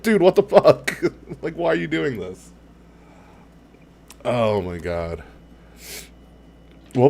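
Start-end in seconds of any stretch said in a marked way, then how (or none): 0.0-2.5 higher, louder mock outrage; mock disbelief at the screen; outraged viewer. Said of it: "you're like".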